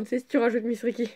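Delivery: ordinary delivery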